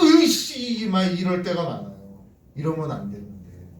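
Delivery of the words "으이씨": mock road-rage curse, higher and louder; re-enacted burst of driving temper; hot-tempered driver's outburst. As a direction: blurt a cross curse, imitating your own impatience. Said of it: "그 성질 급함이 나와요".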